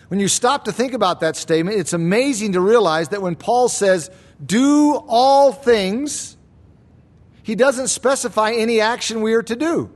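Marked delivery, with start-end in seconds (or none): 4.4-6.4 slow, weighty stress on all things; emphatic quote. Shown as a demonstration. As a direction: Do all things drawn out slowly. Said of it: "the word all comes first for emphasis".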